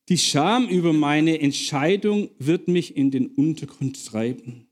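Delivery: ordinary delivery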